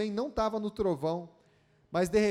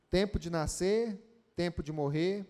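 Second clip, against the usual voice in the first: lower, measured Bible reading; scripture reading; 5 semitones lower than usual. Acted. Reads Ecclesiastes verses aloud.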